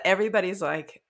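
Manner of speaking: usual speaking voice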